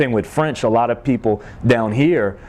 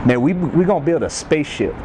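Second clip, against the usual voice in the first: lightly voiced Cajun Boudreaux; proud Cajun reply; Cajun accent; 5 semitones higher than usual. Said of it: "he said".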